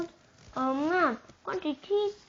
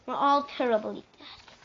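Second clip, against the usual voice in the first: ordinary delivery, nothing marked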